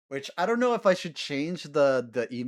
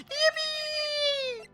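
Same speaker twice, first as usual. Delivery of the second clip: squealed high cheer; a real burst of joy; yippee. Real, not acted. Streamer cheering after solving the case, high squeal. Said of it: "I'm so happy we got through that".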